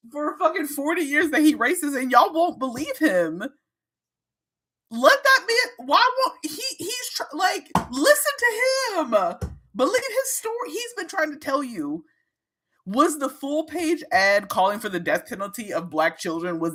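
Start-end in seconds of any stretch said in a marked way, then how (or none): 4.9-12.1 high pleading, close to crying; exasperated plea. Real, not acted. To her audience about Trump, exasperated high plea. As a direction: beg them to listen, half-laughing.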